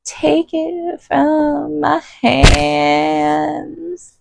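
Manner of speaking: high, slow, hammed-up wailing plea; hammed-up sob crying out Take it from my hands; mock sobbing plea. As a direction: wail it like a fake breakdown. Mock desperate prayer.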